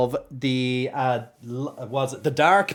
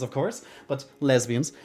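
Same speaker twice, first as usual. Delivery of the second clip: ordinary talk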